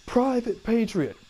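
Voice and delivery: high, slow roll-call bark; drill sergeant. Retold or imitated. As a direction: call out the recruit's name in formation.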